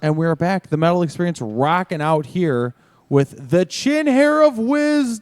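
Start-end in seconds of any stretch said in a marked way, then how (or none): 3.5-5.2 high, drawn-out last word; grand nickname announcement. Pretend. Host introduces Chin Hair of Wisdom to listeners, mock-grand announcing.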